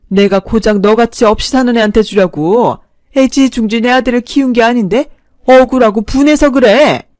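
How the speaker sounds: loud, affronted mother-in-law tirade; indignant anger. Acted. Mother rebukes girlfriend who answered back, indignant anger. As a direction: rail at her for daring to lecture you.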